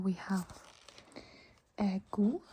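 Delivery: ordinary talk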